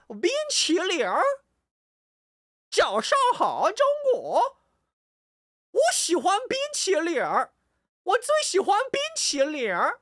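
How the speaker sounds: loud, very high country-twang mainland Mandarin; mainland Chinese accent. Shown as a demonstration. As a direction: belt out an exaggerated mainland accent, swooping up and down. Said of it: "That's how they talk".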